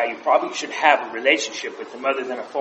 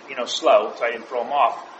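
ordinary talk, nothing marked

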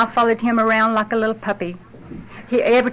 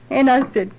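usual speaking voice

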